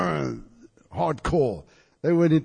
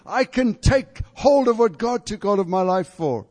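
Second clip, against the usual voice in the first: fervent declaration; 6 semitones higher, 3 dB louder than usual. Retold or imitated. Preacher voices John's declaration.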